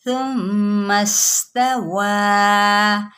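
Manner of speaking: इस्तवा drawn very slowly for four beats; four-beat stretch. Shown as a demonstration. Teacher stretches इस्तवा four beats for students. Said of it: "चार हरकत के बराबर खींच दें".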